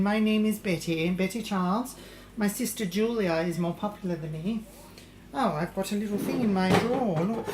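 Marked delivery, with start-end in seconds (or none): none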